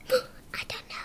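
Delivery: breathless whisper after a gasp. Real, not acted. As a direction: whisper it secretly. Same words repeated in whisper.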